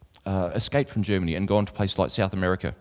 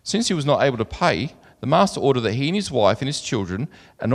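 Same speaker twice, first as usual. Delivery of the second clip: raised, carrying Bible reading voice; louder and higher than his own talk, like reading from the pulpit; scripture reading; 4 semitones higher, 4 dB louder than usual. Acted. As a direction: read the parable out to the congregation. Reads scripture aloud.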